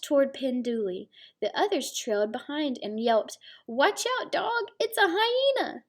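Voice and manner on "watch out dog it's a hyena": high, excited yelp of a dog pack; high yappy dog calling out; yelping wild dogs. Acted. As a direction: shout the warning way up high like a yapping dog.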